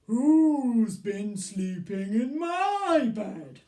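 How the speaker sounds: big loud slow bear voice; booming Daddy Bear. Acted. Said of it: "What question does Daddy Bear ask".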